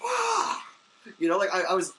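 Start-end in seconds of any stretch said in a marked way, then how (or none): 0.0-0.6 high, strangled frustrated yell; angry yell. Retold or imitated. Cyclist venting anger, high frustrated yell. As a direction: let out a short squeal of rage. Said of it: "I wanted to yell at someone".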